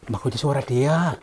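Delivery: normal talking voice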